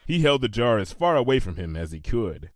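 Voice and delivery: usual speaking voice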